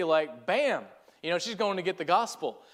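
normal talking voice

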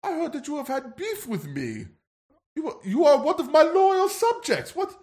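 high, commanding British royal; bad posh British accent. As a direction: demand a bow in a haughty British accent. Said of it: "I'm not doing a good accent".

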